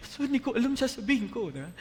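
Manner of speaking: high, loud, panicky squeal; nervous laughing protest; flustered congregant. Retold or imitated. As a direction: play the startled member caught off guard. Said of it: "ikaw ang mag-lead ng prayer mamaya".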